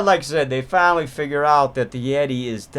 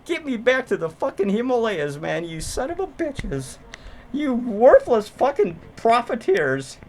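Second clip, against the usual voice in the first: mock Yeti rant; 6 semitones higher than usual. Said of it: "He goes".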